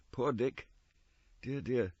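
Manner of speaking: soft, gentle sighing; a touch of pity. Acted. Scrooge to the ghost, softly pitying Dick. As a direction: mourn him lightly.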